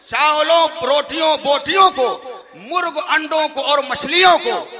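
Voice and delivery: hammed-up mock recitation; mocking recital. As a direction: ridicule each word as you recite it. Mocking slow recital, laughing.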